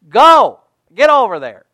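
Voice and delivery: loud, emphatic order; firm command. Acted. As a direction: send someone off with an urgent order. God ordering Ananias to go.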